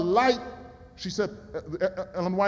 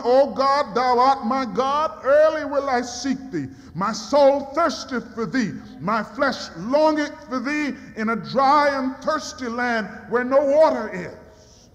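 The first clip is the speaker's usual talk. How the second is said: louder solemn reading of the psalm; psalm recitation; 4 semitones higher, 3 dB louder than usual. Acted. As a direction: read it as a raised prayer.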